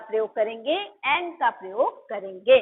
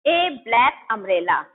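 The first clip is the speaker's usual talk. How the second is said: slow clear example; 2 semitones lower, much slower than usual. Shown as a demonstration. Teacher dictating an example to students, slow and clear. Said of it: "umbrella से पहले यहाँ पे क्या है?".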